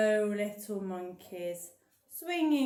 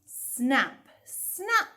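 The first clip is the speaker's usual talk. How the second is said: snappy, louder snaps; crocodile snap; 2 semitones higher, 5 dB louder than usual. Acted. Reading the crocodile's line to kids, a snappy voice. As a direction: snap like jaws. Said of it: "said the crocodile".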